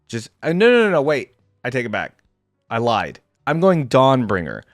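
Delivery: ordinary talk, nothing marked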